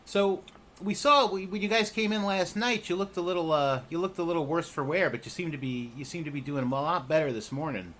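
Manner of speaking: kindly goblin checking on the travellers; goblin Rennie. Acted. GM voicing Rennie.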